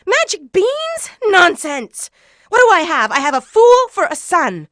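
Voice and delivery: high, loud scolding mother; indignant mother's high-pitched tirade; angry yelling. A narrator's reading. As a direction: yell at a foolish son in outrage. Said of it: "She yelled for a little while".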